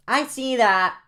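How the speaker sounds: put-on high, loud childish voice; very loud kid. Jaden answering Reggie, very loud. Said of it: "Very loud, 'cause Jaden's voice is very loud".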